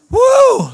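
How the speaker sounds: loud, high "woo"; high whooping cheer. Pretend. Preacher asking who loves Canberra, whooping cheer. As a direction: let out a big crowd-style whoop.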